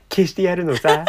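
loud laughing ad catchphrase. Acted. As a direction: deliver it like a commercial tagline. Repeats the ad catchphrase.

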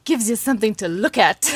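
lightly imitated Yorkshire father; a touch of broad Yorkshire, laugh close behind; broad Yorkshire accent. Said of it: "remember it in a broad Yorkshire accent".

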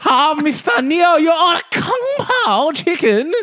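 very high, slow, loud caricature voice; high shopkeeper caricature. Acted. Shopkeeper phoning customer Neil about his order, very high caricature voice. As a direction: squeal it slowly like a cartoon shopkeeper.

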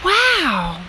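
high, awed breath of a wow. A narrator's reading. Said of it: "breathed Lettice".

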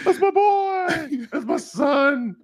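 very high, slow, warbling wail; mock sobbing dad. Joking about the scene, mock-wailing the father. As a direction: overdo a grieving father's cry. Mocking a crying dad clip.

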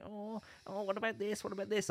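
high, small whiny nitpicking voice; high, quiet mock-fussy nagging; mock nitpicker. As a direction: squeak out petty complaints like a fussy critic. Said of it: "a bit of a negative nanny".

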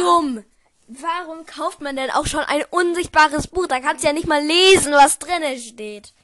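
loud outraged scoffing; loud indignant rant. Real, not acted. To a friend, loudly scoffing at buying an invisible book. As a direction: blast the idea as utterly stupid.

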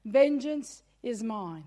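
lightly voiced God. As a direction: intone it as God. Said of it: "the Lord gave me this scripture".

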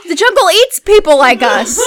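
high, loud, slow objection; mock alarmed protest. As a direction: protest slowly in hammed-up horror. Alarmed objection to shortcut, retold.

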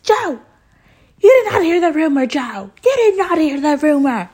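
high, loud mock outburst; flipping out. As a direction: imitate a woman flipping out, pitch shooting up. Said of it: "Marge starts flipping out".

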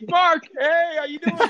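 high, loud cheery greeting through a swollen face; squeaky fake-casual hello with a laugh; mock Megan voice. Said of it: "he fucking talks".

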